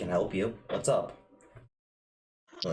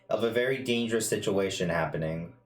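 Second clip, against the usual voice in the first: usual speaking voice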